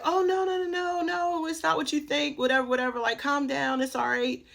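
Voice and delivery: high-pitched fussing, easing down in pitch; imitated reassurance. Aunt to niece who is freaking out, high imitated reassurance. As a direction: hush someone's panic in a high imitated voice.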